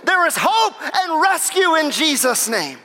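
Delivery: high, slow, ringing proclamation; full-force preaching. Real, not acted. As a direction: proclaim hope to the whole congregation.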